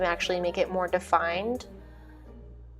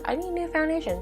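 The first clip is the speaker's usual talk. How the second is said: higher-pitched quote of her own thought; quoting herself; 9 semitones higher than usual. Retold or imitated. Telling viewers about her Sephora trip, higher self-quote. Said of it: "I was like".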